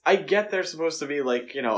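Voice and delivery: ordinary delivery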